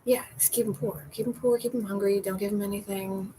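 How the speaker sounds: ordinary delivery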